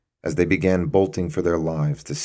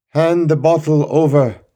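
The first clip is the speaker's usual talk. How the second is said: raised, loud command; firm demand; 11 semitones higher, 8 dB louder than usual. Acted. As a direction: order him to hand it over. Guard demands the bottle.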